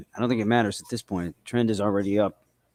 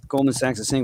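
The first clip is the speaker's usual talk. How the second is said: usual speaking voice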